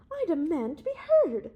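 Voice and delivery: loud, high, indignant demand; a loud, protesting outcry; crying out. A narrator's reading. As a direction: cry out to be heard. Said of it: "cried Doctor Peveril".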